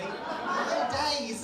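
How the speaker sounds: high, loud comic outrage; loud, indignant exclamation; exasperated exclamation. Real, not acted. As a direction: cry it out in disbelief. Exasperated at endless journey.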